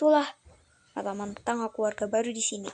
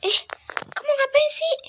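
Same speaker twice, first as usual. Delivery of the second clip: high, loud, startled cry; scared high-pitched yelp; frightened shriek; 14 semitones higher, 7 dB louder than usual. Acted. Girl startled by intruder.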